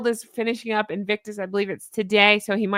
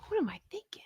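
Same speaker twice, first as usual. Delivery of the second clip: whisper; 7 semitones higher, 11 dB quieter, faster than usual. Real, not acted. Muttering to self in whisper.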